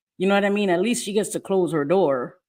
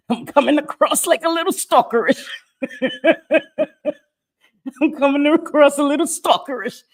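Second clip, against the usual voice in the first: laughing at herself; 9 semitones higher, 5 dB louder than usual. Real, not acted.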